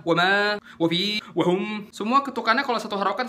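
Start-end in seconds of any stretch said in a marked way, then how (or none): 0.1-1.9 "wama, wafi, wahum" with "wa" clipped; too-short first beat. Shown as a demonstration. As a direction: skim over "wa" in "wahum" in under a beat. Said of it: "mereka juga kecepetan".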